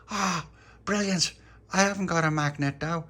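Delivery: high, loud delight; excited child character. A narrator's reading. Tony hearing of the shrapnel hunt, high and excited. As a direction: burst out thrilled at the plan.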